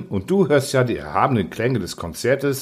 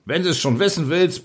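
raised-pitch, impatient answer; bellowing; 5 semitones higher than usual. A narrator's reading. Angel answers Angelmeier while dragging him, mildly bellowed. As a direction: call it out gruffly, without full volume. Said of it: "brüllte der Engel zur Rechten".

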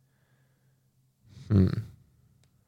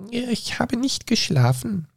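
hoarse, weak voice; 14 semitones higher, slower than usual. Acted. Dormouse insists she wasn't asleep, hoarse and weak. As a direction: protest while barely awake. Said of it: "mit heiserer, kraftloser Stimme".

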